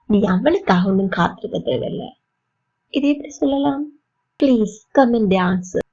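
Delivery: ordinary delivery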